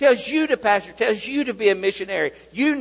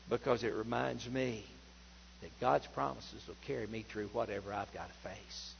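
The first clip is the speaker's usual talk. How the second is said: much softer, lower, confiding sermon voice; hushed intimate preaching; 7 semitones lower, 12 dB quieter than usual. Acted. Pastor quietly urging the faithful to hold God's promise, hushed and low. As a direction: lean in and speak it gently to each listener.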